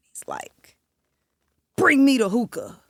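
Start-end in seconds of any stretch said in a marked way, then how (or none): 1.7-2.8 demanding. Retold or imitated. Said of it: "demanding to the room".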